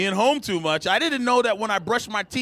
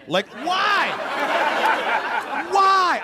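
incredulous high cry; punchy, pitched-up bafflement; exasperated why; 9 semitones higher, much slower than usual. Acted. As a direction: wail the question in comic disbelief. Baffled at his own laziness.

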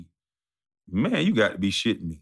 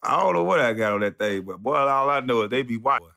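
ordinary delivery

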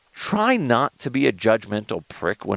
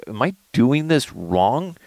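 high-pitched acted exasperation; frazzled parent at a loss; overwhelmed parent; 6 semitones higher than usual. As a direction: plead in helpless bafflement, pitched up. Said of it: "you just feel alone".

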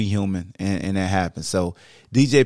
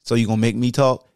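ordinary delivery

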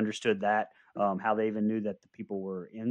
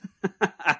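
much higher leering chuckle; bus driver laugh; 8 semitones higher than usual. Retold or imitated. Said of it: "I don't know what that accent was".

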